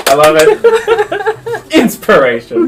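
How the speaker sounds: high laughing delight. Real, not acted. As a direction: laugh the words out. GM laughing reply.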